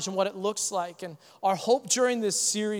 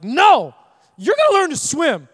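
much higher and louder, teasing firmness; playful shout; 9 semitones higher, 9 dB louder than usual. Retold or imitated. Uncle refuses to move closer, a playful loud call at the pool. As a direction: call out like a tough-love uncle. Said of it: "I was like".

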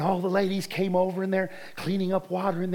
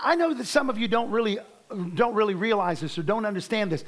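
ordinary delivery

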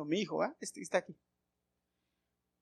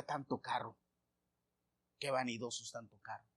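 quiet, mocking inner voice; soft disapproving grumble; scornful muttering to himself; 6 semitones lower, 9 dB quieter than usual. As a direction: scoff under your breath at the vanity. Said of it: "me decía".

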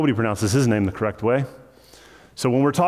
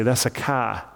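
imitating a New Jersey "car" with no R; lightly mocked New Jersey accent. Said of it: "if you go to New Jersey and you ask somebody".

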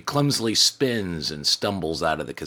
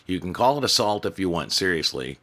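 ordinary delivery

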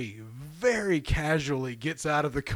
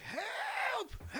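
imitated cry for help; 8 dB quieter than usual. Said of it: "we hear, like".

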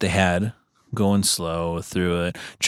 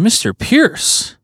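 mock stern judge; 7 semitones higher, 3 dB louder than usual. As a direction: stretch his name out like a judge passing sentence. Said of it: "He's like".

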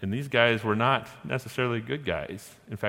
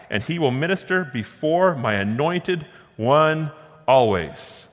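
loud, higher-pitched proclamation of a verse; forceful scripture reading; 5 semitones higher, 5 dB louder than usual. Acted. Preacher declares God's promise of a priest, loud and emphatic. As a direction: proclaim it firmly, drawing out the final words.

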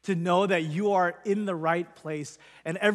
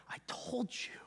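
soft whisper; 4 semitones higher, 15 dB quieter than usual. Retold or imitated. God's voice in the story.

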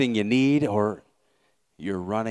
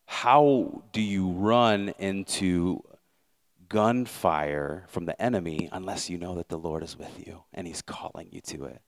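ordinary talk

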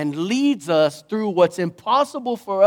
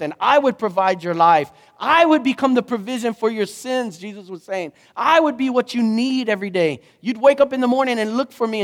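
raised pitch, driving sermon delivery; impassioned preaching; 5 semitones higher than usual. Acted. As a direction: preach it with momentum, line after line. Preaches in Jesus' words.

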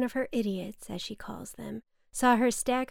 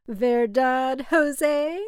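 a touch louder, playful verdad; lightly voiced mother flaunting Spanish; slightly hammed-up Spanish tag question; showy Spanish aside; 3 semitones higher, 7 dB louder, much slower than usual. Mother shows off Spanish to driver.

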